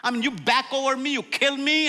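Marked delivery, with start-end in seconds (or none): none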